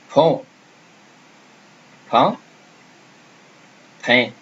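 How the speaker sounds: "Pont", "Pain", "Pain" with nasal vowels; French drill. Shown as a demonstration. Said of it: "let's say the words again".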